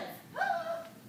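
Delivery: sudden frightened gasp in; sharp gasp. Acted. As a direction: gasp as if shocked underwater. Terrified by shark attack.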